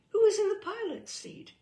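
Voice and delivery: high, loud alarmed question; rising alarm at the photo. Acted. Guest grows alarmed looking at family photos.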